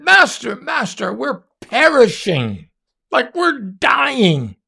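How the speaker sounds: mock the frightened disciples begging for rescue; panicked disciples. Preacher voicing disciples waking Jesus in the storm, high and panicked. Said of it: "And they said".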